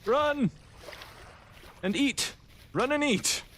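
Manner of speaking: panicked high yelling; frantic shouts while fleeing; terrified horror victim. Real, not acted. Panicked flight from a bear.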